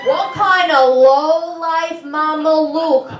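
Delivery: hammed-up high New York Italian tirade over stolen lasagna; furious rant. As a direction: blow up like a mobster over lunch. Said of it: "I was like".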